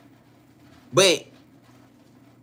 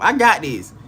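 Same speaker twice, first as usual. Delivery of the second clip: usual speaking voice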